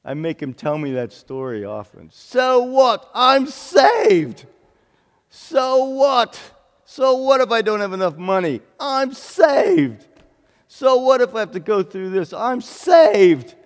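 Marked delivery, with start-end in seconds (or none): 2.1-13.5 high, jubilant British shout; loud, elated imitation of a Brit; British accent. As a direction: belt it out defiantly in a British accent. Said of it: "In his great British accent".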